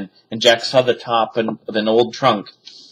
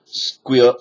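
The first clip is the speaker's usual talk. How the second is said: plain reading voice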